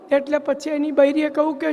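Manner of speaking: normal talking voice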